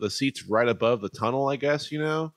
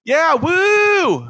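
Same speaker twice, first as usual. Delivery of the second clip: high, loud drawn-out whoop; mock fan cheer; 6 dB louder than usual. Clowning about wooing for the Spurs, loud cheer. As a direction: whoop like an over-keen fan.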